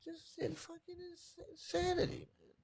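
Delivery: very faint, high, halting voice; real hurt, fading to almost nothing; overcome with upset. Real, not acted. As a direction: barely get the words out. Overcome after talk of daughter.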